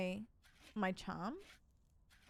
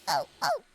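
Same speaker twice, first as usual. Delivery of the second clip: high squeals; 6 dB louder than usual. Pretend.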